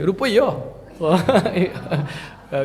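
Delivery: usual speaking voice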